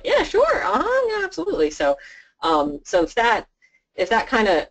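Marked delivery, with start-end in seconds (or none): 0.0-1.7 very high squeaky eager yes-man; eager agreement. Retold or imitated. Advisor imitates the client agreeing to make coffee, very high. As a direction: pipe it up like a giddy yes-man. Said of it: "he was all about it".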